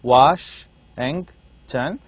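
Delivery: Washington drawn out slowly in three separate beats; syllable by syllable. Shown as a demonstration. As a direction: break Washington into Wash, ing, ton with gaps. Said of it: "has three syllables".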